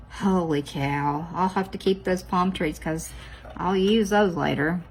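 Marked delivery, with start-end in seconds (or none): none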